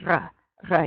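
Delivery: "R" like coughing at the back of the throat; phlegmy French R. Shown as a demonstration. Teacher compares it to horking something up, phlegmy R. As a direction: hork up each "R". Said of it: "kinda like you're coughing".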